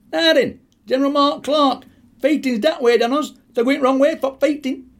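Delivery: high, loud Yorkshire call; calling out to general. Retold or imitated. [name] calls to the American general, high and loud. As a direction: shout a cheeky tip at the general. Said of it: "I says to him".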